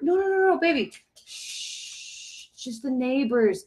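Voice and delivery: high, soft hushing of a pet; softly shushing the dog at the window. Real, not acted. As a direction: soothe the barking dog, softly.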